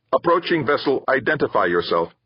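high synthesized computer voice; flat machine hail from the speaker. Acted. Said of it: "sounded like a computerized synthesizer".

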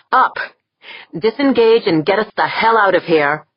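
forceful order; commanding shout. Acted. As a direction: bark the order loud. Corvus orders retreat.

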